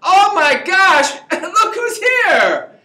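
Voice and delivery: high, slow, hammed-up gasp of surprise; mock surprise. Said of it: "act surprised, going".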